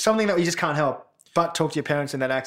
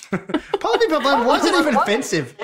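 laughing; 9 semitones higher than usual. Real, not acted. Laughing over a silly nickname.

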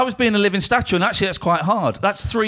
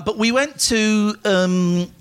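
normal talking voice

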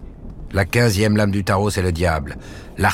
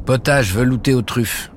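higher, proud read-out of the menu; reading the menu aloud; 6 semitones higher than usual. A narrator's reading. The cook reads her menu aloud to the visitor, proudly. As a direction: announce each dish like a proud cook. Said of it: "Elle lit à voix haute".

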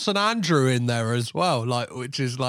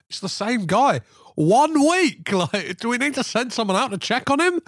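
alarmed stats checker; 6 semitones higher than usual. Acted. Apple staffer tracking a repeat renter, mock alarm. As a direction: play a worried employee in comic disbelief. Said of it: "tracking the I P address going".

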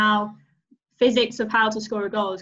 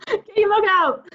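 laughing; 9 semitones higher, 4 dB louder than usual. Real, not acted. Laughing demand at Amy.

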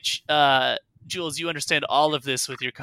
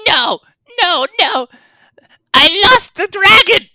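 very high, loud wailing creature voice; small creature in anguish; shuddering, distraught kobold; 13 dB louder than usual. Acted. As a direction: wail the confession in a squeal. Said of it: "Meepo shudders and says".

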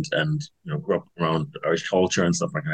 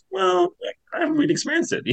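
quoting herself much higher, light and innocent; mock innocent self. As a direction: say it high and wide-eyed, a little sheepish. Quoting herself to her brother.

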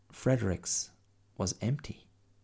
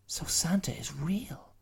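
put-on breathy high girlish whisper; 10 semitones higher than usual. Mia whispering about Santa to the bear. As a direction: whisper the secret back.

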